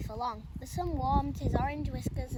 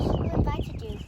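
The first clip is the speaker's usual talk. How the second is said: snarling; 4 semitones higher, 9 dB louder than usual. Acted. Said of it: "snarled the tattered grey cat".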